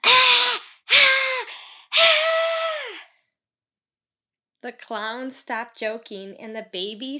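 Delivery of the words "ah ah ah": very high, loud repeated screams; girl screaming to steam. Retold or imitated. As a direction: scream each ah higher. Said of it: "She's like".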